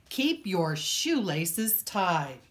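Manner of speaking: ordinary delivery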